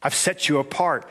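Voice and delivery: ordinary talk, nothing marked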